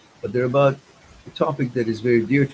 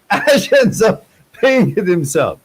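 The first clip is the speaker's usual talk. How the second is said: laughing aloud; 8 semitones higher, 8 dB louder, slower than usual. Real, not acted. Laughing at the punchline.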